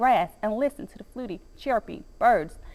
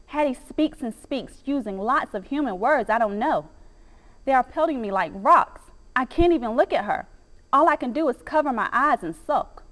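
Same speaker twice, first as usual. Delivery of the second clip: ordinary delivery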